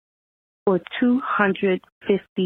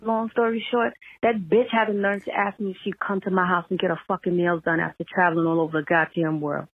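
normal talking voice

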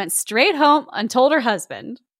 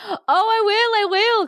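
high-pitched, eager Susan; eager cry; 11 semitones higher than usual. Acted. Susan accepts the neighbor's secret offer, eager cry. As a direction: jump at the offer. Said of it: "cried Susan".

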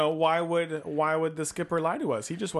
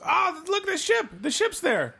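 high, loud excited shout; thrilled discovery, voice shooting up; 4 dB louder than usual. Acted. Spotting the lost ship.

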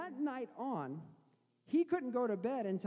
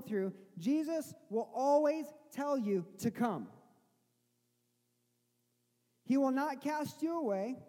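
raised, loud preacher voice; preaching; 6 semitones higher, 4 dB louder than usual. Acted. Pastor urging the congregation not to fear, loud fervent preaching. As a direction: build it up and proclaim to the congregation.

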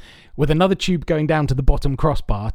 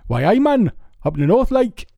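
put-on a rough, self-admittedly poor Newcastle-area northern English accent, pitched a bit higher; bad Geordie accent; 7 semitones higher than usual. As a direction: imitate your mate's northern English Geordie accent badly, for laughs. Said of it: "I'm terrible at doing it ... They talk like that".

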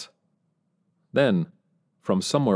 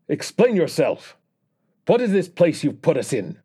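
higher, demanding Russian leader's bark; 9 semitones higher, 3 dB louder than usual. Acted. Russian leader barking back at the US president, raised demanding voice. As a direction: snap the demands out of the shadows. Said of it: "barked back".

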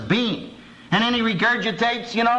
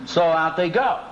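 ordinary delivery

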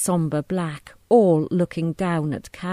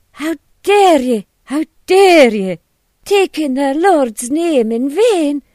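loud, high, slow indignation; scandalised wife raging; furious outrage; 8 semitones higher, 10 dB louder, slower than usual. A narrator's reading. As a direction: shout it in outrage, stretching the words. Missus Hughes rages at the deceiver.

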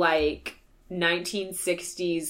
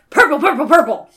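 higher and louder, emphatic; excited; 5 semitones higher, 10 dB louder than usual. Real, not acted. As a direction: insist on the color, rising excitement. Emphatic purple description.

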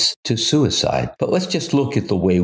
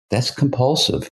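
usual speaking voice

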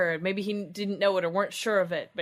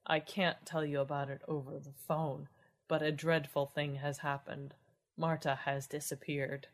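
dead, hopeless tones; 3 semitones lower, 3 dB quieter than usual. Acted. Sculptor phoning a friend about a disappearance, dead hopeless voice. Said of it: "Dead, hopeless tones of the voice".

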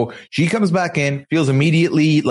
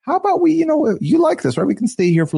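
much higher, coaxing mock pitch; tentative wheedling suggestion; 12 semitones higher than usual. Host imitates Jibri subtly persuading Miona to stay, high wheedling voice. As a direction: float it up high, as if slipping the idea in. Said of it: "he's trying to throw out".

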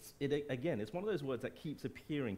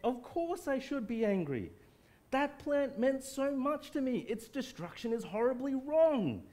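high, loud complaining voice; petulant protest pitched high and loud; whiny Jonah; 9 semitones higher, 4 dB louder than usual. Retold or imitated. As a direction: complain to God like a sulking child. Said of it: "as if he's saying to God".

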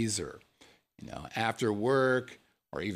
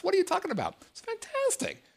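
much higher, gushing delight; mock amazement. Raving to listeners about cauliflower crust, high excited gush. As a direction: gush over it like a miracle.

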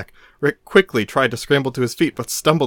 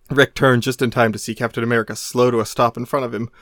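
usual speaking voice

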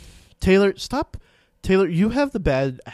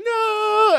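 mock wail; 6 dB louder than usual. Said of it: "then she's like".

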